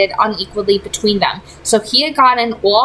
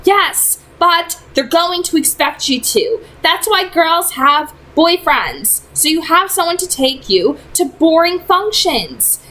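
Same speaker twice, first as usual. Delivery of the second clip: put-on lightly raised young woman's voice; a teen girl pleading with a friend; gently higher voice of an exasperated girl; Clary's voice; 6 semitones higher than usual. A narrator's reading. Clary explaining to Simon.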